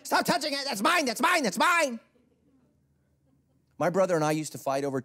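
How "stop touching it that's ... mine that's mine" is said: put-on high, loud little kid claiming toys; bratty toddler yelling mine; whiny child. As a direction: whine it like a possessive toddler. Child voice fighting over toys.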